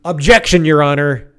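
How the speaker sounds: loud, hammed-up courtroom objection; mock lawyer. Mock lawyer objecting to the judge about leading questions, loud objection. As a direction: object loudly like a trial lawyer.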